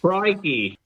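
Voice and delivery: mock Australian exclamation, pitched high; Australian accent. Teasing co-host about an actress's Australian accent, mock Australian. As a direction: throw out a hammed-up Australian catchphrase. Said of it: "I can't even do the accent".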